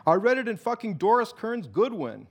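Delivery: high, pushy insistence; insisting. A narrator's reading. Martin defends his odd fact to Alex, insistent and high. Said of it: "Martin insisted".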